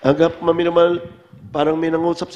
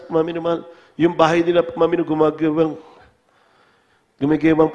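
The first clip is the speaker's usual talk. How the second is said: ordinary talk, nothing marked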